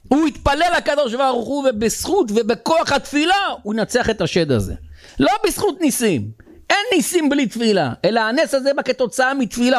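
high, emphatic sermon delivery; impassioned preaching. Real, not acted. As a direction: preach it with rising force, pitch held high.

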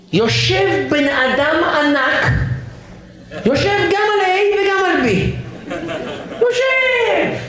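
high, drawn-out comic amazement; hammed-up exclamation. Comedian describes a giant filling two seats, hammed-up amazement. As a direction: stretch it out high for comic effect.

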